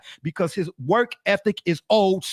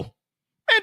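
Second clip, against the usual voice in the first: high incredulous man. Pretend. GM role exclaims.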